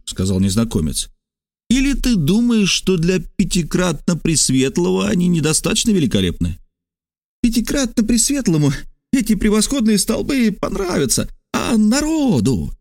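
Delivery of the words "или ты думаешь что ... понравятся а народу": high, insinuating character voice; probing Trasul. Acted. Trasul tests the stranger on Caesar's columns, high probing voice. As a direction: pitch it high to draw the other man out.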